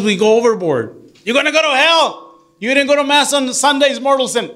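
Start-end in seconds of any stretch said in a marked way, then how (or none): 1.2-4.5 mock hellfire scolding. Mocking overzealous hellfire Catholics.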